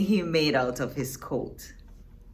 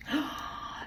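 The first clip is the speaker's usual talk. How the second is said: gasp; 9 semitones higher, 9 dB quieter than usual. Acted. Dramatic gasp at page turn.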